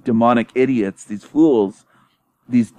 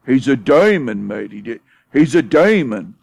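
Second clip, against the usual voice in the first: Love Bum impression, British accent; 2 semitones higher than usual. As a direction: mimic a Brit, stretching "demon" mockingly. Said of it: "he had a British accent".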